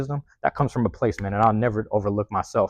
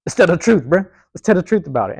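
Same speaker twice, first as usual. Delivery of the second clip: higher and louder, punching "tell"; emphatic call-out; 7 semitones higher, 6 dB louder than usual. Real, not acted. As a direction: call it out loud, no more hiding. Calling out the truth.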